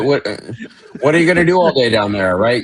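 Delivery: mock exasperated addict. Mocking junkie life.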